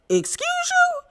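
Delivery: very high, soft, indignant excuse you; offended retort. Retold or imitated. Jacqueline shocked by Mia's rudeness, squeaky high indignation. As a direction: act scandalized and affronted. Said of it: "Jacqueline at this point was like".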